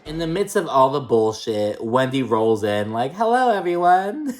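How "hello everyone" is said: high, sing-song mock hello; mock cheery greeting. Vlogger imitating Wendy strolling into the tense dinner, high cheery mock. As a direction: breeze in with a fake-bright hello.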